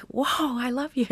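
high-pitched, lightly acted awe; mock gushing teenager. Teacher imitating teens suddenly won over, gushing.

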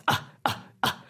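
short, breathy chimp grunts; low gruff grunts. Shown as a demonstration. Scientist imitating unimpressed Edinburgh chimps, low gruff grunts. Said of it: "low-pitched, um, quite gruff-sounding grunts".

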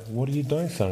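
lightly voiced concerned mother; mum's puzzled question. Mum questioning sleeping son.

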